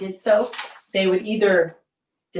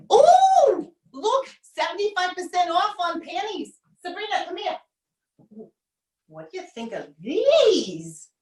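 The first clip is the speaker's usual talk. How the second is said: high, gushing mom voice; mom at a sale; 8 semitones higher than usual. Acted. Mother gushing to her daughter about panties. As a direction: coo over the bargains like an excited mother.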